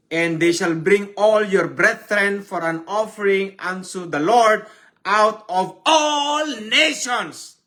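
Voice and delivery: loud, high proclaimed verse; fervent preacher proclaiming scripture; preaching at full force. Acted. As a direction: cry the verse out to the church. Rereading verse with more force.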